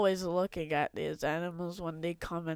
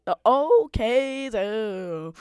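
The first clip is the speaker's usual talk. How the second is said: announcer; 3 semitones higher, 8 dB louder, much slower than usual. Acted. Grand name announcement.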